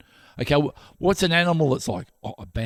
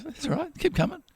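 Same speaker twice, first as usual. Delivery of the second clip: put-on calm old boxer; 12 semitones higher, 6 dB quieter than usual. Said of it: "He goes".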